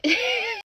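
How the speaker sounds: high, loud burst of laughter; sudden high-pitched laughing fit. Real, not acted. Storyteller cracks up mid-story, high laughing.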